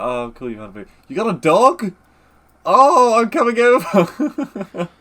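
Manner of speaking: rising to a loud, high, laughing shout; gush, then laugh out the last line; excited. Retold or imitated. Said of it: "It's like".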